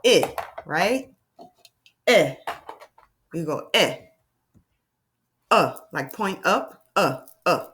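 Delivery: short vowels said one by one with pauses; vowel sounds. Shown as a demonstration. Teacher leading vowel sounds on the screen. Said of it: "you can make the sound if you want".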